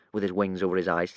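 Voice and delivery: ordinary delivery, nothing marked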